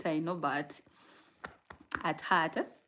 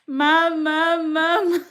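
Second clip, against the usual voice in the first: high, loud drawn-out wail; imitated baby cry; 10 semitones higher, 11 dB louder, slower than usual. Mother copying her baby's special cry, high mock wail. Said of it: "there is this special cry".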